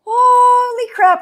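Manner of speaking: high, loud and drawn out; startled yelp of alarm; shocked cry. Real, not acted. Sent the wrong link.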